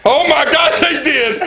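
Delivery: high mock alarm through laughter; fake shock. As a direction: play along, pretending to be shocked. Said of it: "the only response I can say".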